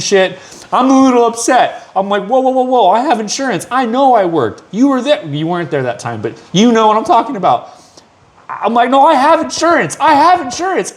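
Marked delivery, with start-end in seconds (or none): 2.3-5.2 high, urgent protest; frantic pleading. Retold or imitated. Father pleading with hospital staff, high and urgent. 8.9-10.9 much higher, pressing plea; desperate insisting. Retold or imitated. Father begging staff to accept his insurance, very high.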